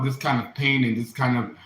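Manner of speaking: normal talking voice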